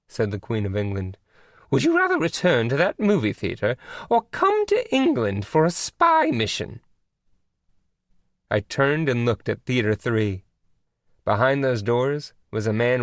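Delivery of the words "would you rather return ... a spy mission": lofty high royal tone; queenly voice. A narrator's reading. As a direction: scold gently with royal poise. Queen of England on phone.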